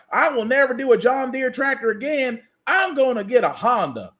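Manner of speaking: a hint of an offended farmer swearing off the brand; mocking angry customer. Comedian imitates John Deere fans quitting over DEI policy, mild mock huff. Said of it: "That's how they sounded".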